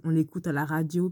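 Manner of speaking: normal talking voice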